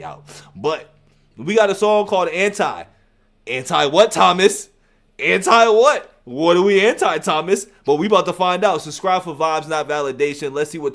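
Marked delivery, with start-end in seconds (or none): 3.5-7.7 louder, probing questions at the artist; loud mock challenge. Real, not acted. Reactor loudly quizzing the rapper about his song title. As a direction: call him out teasingly.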